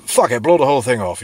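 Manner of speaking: high, loud mock royal shrug; dismissive king. King George dismissing the war, loud mock voice. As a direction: toss it all off as the king. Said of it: "King George said".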